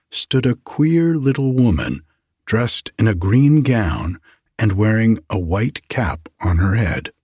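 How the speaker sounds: plain reading voice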